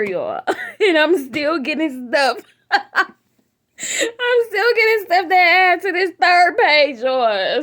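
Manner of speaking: loud, high laughing cry; can't stop laughing at the list; laughing through tears. Real, not acted. Laughing crying about list.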